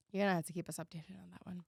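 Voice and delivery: fast hushed whisper. Real, not acted. Asking her cohost for updates, fast whisper. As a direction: whisper it quickly aside. Unvoiced quick aside.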